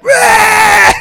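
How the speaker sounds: very high, very loud bark of fright; a startled shriek; panicked yell. Retold or imitated. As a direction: yelp and bolt for the door. Caught man screams and runs.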